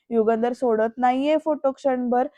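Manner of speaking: plain reading voice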